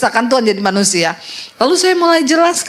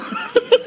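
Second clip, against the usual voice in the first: tawa geli berbisik; tawa kecil tanpa suara; 10 semitones higher, 4 dB quieter than usual. Real, not acted. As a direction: terkekeh pelan tanpa suara. Tertawa soal kecoa. (tawa berbisik spontan)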